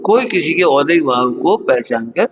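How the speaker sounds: ordinary talk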